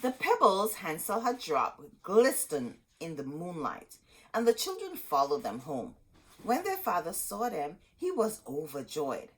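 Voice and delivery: put-on lively read-aloud to kids; storybook reading. A narrator's reading. Teacher reading Hansel and Gretel aloud to children. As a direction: read the tale with gentle drama.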